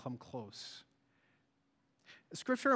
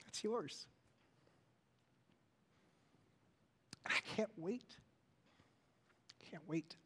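hushed awe; 6 dB quieter than usual. Real, not acted. Hushed awe after song.

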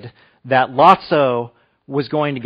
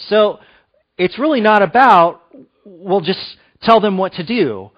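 lifted, higher pulpit voice with pushed questions; raised preaching; 5 semitones higher, 4 dB louder than usual. Acted. Pastor urging the congregation on how God treats us, preaching voice. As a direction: preach it out to the room, building.

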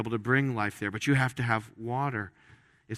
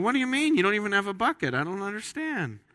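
high-pitched mock bafflement; imitating a woman's confused disbelief, pitched up; baffled woman; 8 semitones higher than usual. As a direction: ask it like a puzzled woman who can't follow. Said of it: "she's going".

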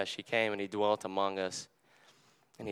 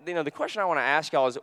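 ordinary talk, nothing marked

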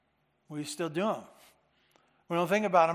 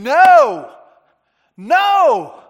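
shouted No, far higher and louder, like slamming a desk; adamant shout; 8 semitones higher, 16 dB louder than usual. Shown as a demonstration. Preacher shows Paul's refusal with a furious shout. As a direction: slam the desk and bellow No. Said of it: "slamming the hand upon the desk and saying".

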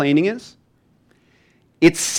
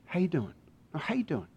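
very soft, sincere question; near-murmured caring check-in; tender, probing friend; 15 dB quieter, faster than usual. Acted. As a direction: ask it hushed, to reach the real person. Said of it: "until they get the real you".

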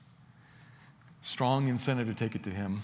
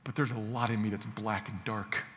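ordinary talk, nothing marked

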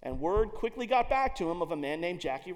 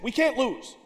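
proud proclamation; 2 semitones higher, 9 dB louder than usual. Retold or imitated. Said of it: "is rumored to have said".

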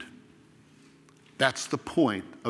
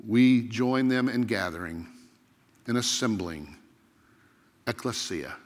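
opening prayer; 4 semitones lower than usual. Real, not acted. Pastor leads opening prayer.

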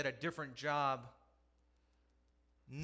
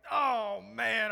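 loud, higher oh man at the broken lamp; a sudden groan of dismay, raised and loud; dismayed exclamation; 8 semitones higher, 12 dB louder than usual. Retold or imitated. Father to himself over the broken lamp, loud dismay. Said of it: "I'm like".

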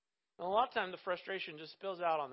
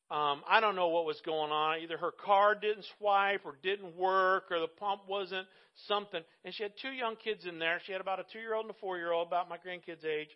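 ordinary delivery

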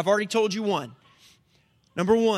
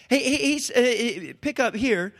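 ordinary talk

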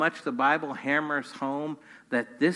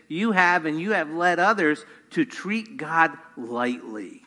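ordinary talk, nothing marked

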